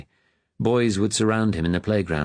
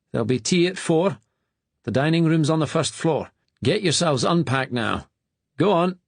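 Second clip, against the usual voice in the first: stern master; 9 semitones higher than usual. A narrator's reading. Master gives orders.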